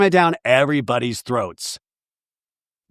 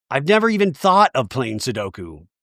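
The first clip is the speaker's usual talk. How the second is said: ordinary delivery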